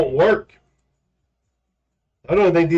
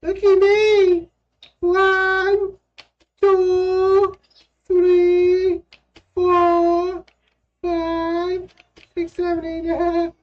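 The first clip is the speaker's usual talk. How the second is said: high, steady counting voice; doing jumping jacks; much slower than usual. Pretend. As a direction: count out each jump slowly.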